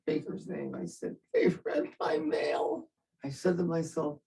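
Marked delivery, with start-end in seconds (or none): 1.3-2.9 much higher, slow anguished cry; slow high shocked outcry. Retold or imitated. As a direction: wail it out in disbelief. Shocked realization his mail was read.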